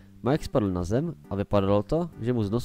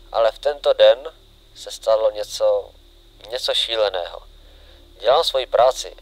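louder, emphatic first-person account; diary reading; 8 dB louder than usual. Acted. William Afton confesses in his diary, loud and dramatic. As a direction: read it out forcefully in short, dramatic phrases.

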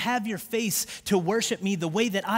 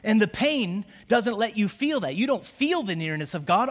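ordinary talk, nothing marked

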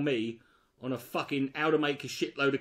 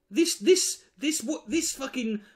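exasperated outburst; 7 semitones higher than usual. Real, not acted.

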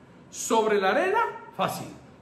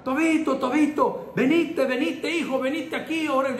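put-on distraught old woman; 5 semitones higher than usual. Pastor imitating an elderly church sister begging him to pray, high and pleading. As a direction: beg him to come over, voice pitched up in distress. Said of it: "muy exaltada".

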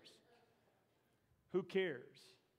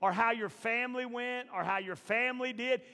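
higher and louder, pushing the crowd; preaching at full force; 9 semitones higher, 14 dB louder than usual. Acted. Preacher rousing the congregation, full force. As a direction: preach it, rising.

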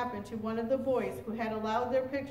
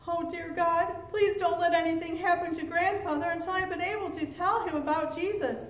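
higher-pitched pleading prayer; earnest prayer; 6 semitones higher than usual. A narrator's reading. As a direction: plead anxiously with God. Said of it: "Domingue prayed in his heart".

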